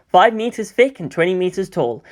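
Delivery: ordinary delivery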